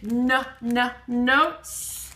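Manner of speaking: "na, na, notes" in separate beats; first sound repeated. Shown as a demonstration. Teacher stressing the starting n, "na, na, notes". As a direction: tap the n twice before "notes". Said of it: "The na is at the beginning".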